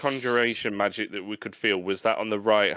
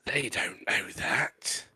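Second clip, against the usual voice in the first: slow, hushed whisper; conspiratorial whisper; 6 dB quieter, slower than usual. Pretend. Player covering for imaginary spectacles, conspiratorial whisper. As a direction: whisper it like a secret aside. Secret aside about pretend spectacles.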